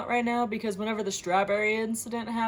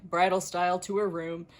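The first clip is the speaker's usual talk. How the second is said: ordinary talk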